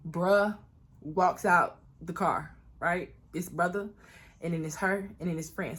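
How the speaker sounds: ordinary talk, nothing marked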